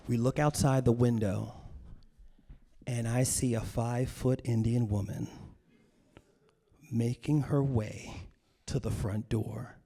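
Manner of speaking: ordinary talk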